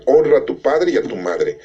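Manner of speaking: ordinary talk, nothing marked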